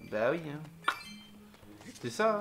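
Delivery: ordinary talk, nothing marked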